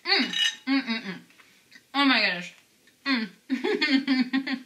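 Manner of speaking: chewing while speaking; muffled savoring murmurs; mouth full. Real, not acted. Seen 3: Bites topped cookie at -3, chews with closed lips throughout.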